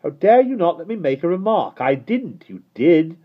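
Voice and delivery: high, loud bickering; children squabbling, shouting; angry quarrel. A narrator's reading. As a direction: snap back and forth. Quarrel continues.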